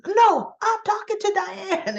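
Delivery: high, sharp cutting-off retort; a grieving daughter cuts off her friend, high and sharp. Retold or imitated. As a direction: shut him down, high and pointed. Summer snaps back at Jack.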